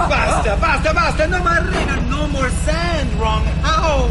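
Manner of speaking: put-on frantic, heavily accented complaint; exasperated protest. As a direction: throw up your hands at the sand. Armando refuses to go on.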